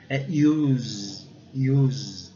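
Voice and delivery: "use" as yooz, with long buzzing Z; English pronunciation demo. Shown as a demonstration. Teacher models the verb, careful pronunciation. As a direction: stretch "use" from a you sound into Z. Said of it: "No S com som de Z".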